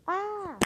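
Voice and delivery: short high-pitched mock scream. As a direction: act out a girl's high scream. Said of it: "she would scream like".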